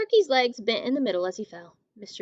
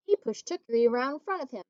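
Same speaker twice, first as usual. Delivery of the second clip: usual speaking voice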